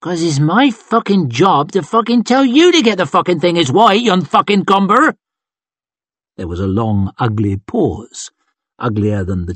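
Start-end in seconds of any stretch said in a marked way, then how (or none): 0.0-5.1 high, heated sweary rant; angry retort. Acted. Robin hurls it back at Yon, high angry retort. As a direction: hammer the insult home, pitched up.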